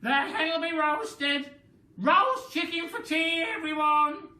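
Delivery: very high, loud cackling call; gleeful shout. Acted. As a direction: crow it out to everyone. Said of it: "Grandma shouted".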